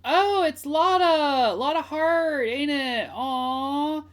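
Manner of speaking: high, loud, drawn-out delight; excited squeal. Real, not acted. Streamer recognising Lotta Hart, excited squeal.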